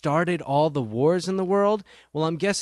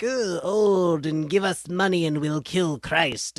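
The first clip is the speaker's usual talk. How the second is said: movie villain voice; slower than usual. Retold or imitated.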